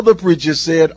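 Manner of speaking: ordinary talk, nothing marked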